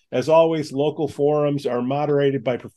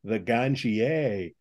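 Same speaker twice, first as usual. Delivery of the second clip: ganjier drawn out slow and low; slow emphasis on ganjier; 5 semitones lower, 5 dB quieter, much slower than usual. Acted. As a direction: linger on the word ganjier as a reveal. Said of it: "get this".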